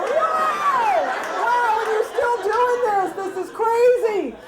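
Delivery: high, loud, incredulous cry; shocked outburst. Acted. Embarrassed patient reacts to doctor still carrying on, in shrieking mock shock. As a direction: blurt it out in hammed-up disbelief. Acted shocked thought during the exam.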